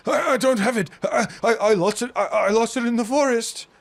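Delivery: high, loud, stammering in terror; terrified stammer. Acted. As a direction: beg through stammers, frightened. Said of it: "the man's like".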